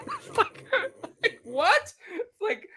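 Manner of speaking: words dissolving into a very high giggle; laughing. Real, not acted. Still amused at Lisa's denial, speaking through high laughter.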